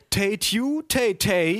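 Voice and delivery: high siren chant turned into mock English; German-accented English translation. A narrator's reading. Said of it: "übersetzte der Freund meiner Cousine". (Martinshorn auf Pseudo-Englisch mit Akzent)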